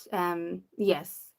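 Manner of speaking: usual speaking voice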